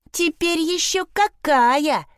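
high, slow, emphatic boast; proud declaration. A narrator's reading. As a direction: announce it proudly, drawing the words out. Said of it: "гордо объявила Вильма". (гордое, протяжное заявление высоким голосом)

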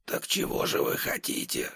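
hushed whisper, no voice. Acted. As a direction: whisper it, low and tense. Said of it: "прошептал он".

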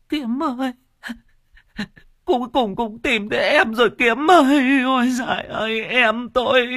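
very high keening lament; wailing grief. Acted. Xuân wailing over her drowned brother, high keening. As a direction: wail the brother's name in grief. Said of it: "khóc giống lên".

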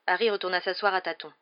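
plain reading voice

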